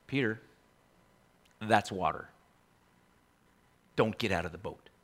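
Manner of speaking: quiet plea to Peter; soft warning. Acted. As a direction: murmur a worried warning.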